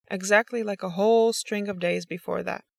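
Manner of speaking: ordinary delivery, nothing marked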